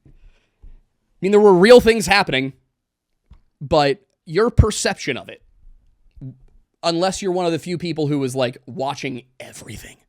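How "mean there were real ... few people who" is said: emphatic. Real, not acted. Emphatic point about trials.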